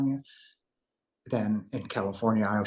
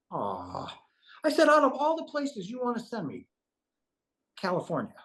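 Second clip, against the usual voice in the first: whiny dismay; 11 semitones higher than usual. Retold or imitated. Complaining about being sent to California, dismayed. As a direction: protest high and plaintive, sagging at the end.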